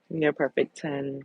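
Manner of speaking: ordinary talk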